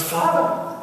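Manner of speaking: high startled "Father!"; a sudden high yelp of surprise; surprised cry. Retold or imitated. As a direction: jump in surprise at the priest. Man surprised at priest entering.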